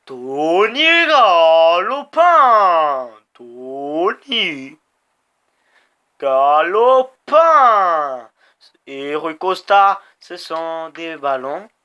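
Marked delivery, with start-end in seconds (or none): 0.1-3.1 loud, very slow stretched name call; long loud sportscaster cry; drawn-out announcer call. Real, not acted. Player hyping his rider's name. 3.3-8.3 name broken in two, each half stretched; two-part loud stadium call; split announcer call. Real, not acted. Player hyping his rider's name.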